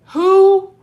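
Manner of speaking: high, loud falsetto "who"; high-pitched mock reply. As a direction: call it out high, imitating the man at the door. Acting out Gein answering police knock.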